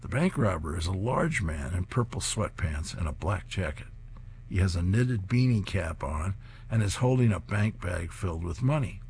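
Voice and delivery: ordinary delivery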